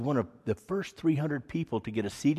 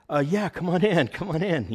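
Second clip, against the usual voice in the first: flustered welcome; 6 semitones higher, 4 dB louder than usual. Retold or imitated.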